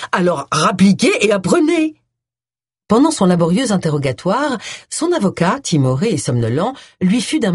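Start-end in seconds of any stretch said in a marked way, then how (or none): 0.1-2.0 brusque retort. A narrator's reading. Client retorting to her reluctant lawyer, brusque. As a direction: retort abruptly, pushing the words out. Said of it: "elle rétorqua brusquement".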